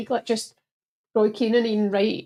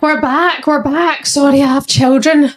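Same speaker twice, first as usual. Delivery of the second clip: loud; 5 semitones higher, 10 dB louder than usual. Real, not acted. Returning to live stream, excited.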